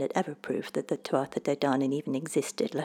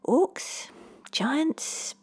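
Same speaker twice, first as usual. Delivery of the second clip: incredulous, much higher questions; you can't be serious; disbelief; 10 semitones higher than usual. Acted. Kyle hearing that orcs and giants live nearby, high disbelief. Boy reacts to trolls claim.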